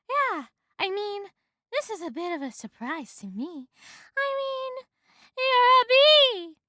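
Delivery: high-pitched, escalating disbelief; stunned woman. A narrator's reading. Woman stunned by a talking bee, increasingly shrieking. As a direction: climb high, ending in a loud squeal.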